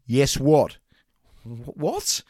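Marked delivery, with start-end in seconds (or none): 1.4-2.2 higher, meek and hesitant; timid. Acted. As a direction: answer like a cowed recruit. Cowed pupil answers.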